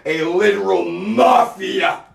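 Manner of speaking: shouted out, high and loud; angry shout. Real, not acted. Ranting to viewers, shouting in anger. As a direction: yell the accusation.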